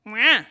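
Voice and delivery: put-on short, loud, high-pitched baby wail; imitated baby cry. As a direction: do the baby crying, one sharp burst. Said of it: "She cried again".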